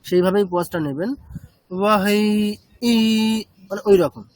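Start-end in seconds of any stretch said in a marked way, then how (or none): 1.8-2.9 বাহাই with a tiny break before the last ই; বাহাই said with a slight stop and a jolt on the last vowel; pause before final i. Shown as a demonstration. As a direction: say বাহাই, then a small push on ই. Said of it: "একটা ধাক্কা দেওয়া".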